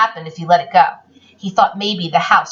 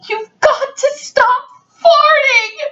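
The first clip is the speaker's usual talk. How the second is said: put-on high, plaintive child's voice; hammed-up tearful plea; pleading child; 11 semitones higher than usual. Child pleads with dog in story.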